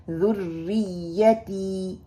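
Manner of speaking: ذریتی with the final تی stretched long; lengthened ending. Shown as a demonstration. Teacher showing how long the ending should be. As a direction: draw out the تی of ذریتی. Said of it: "تی کو لمبا کرنا ہے".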